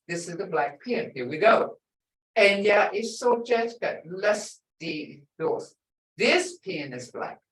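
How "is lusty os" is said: "is lusty os" stretched very slowly, word by word; pause after subject. Shown as a demonstration. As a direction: draw out "is lusty os" with gaps between the parts. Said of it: "Often there is a pause after the subject".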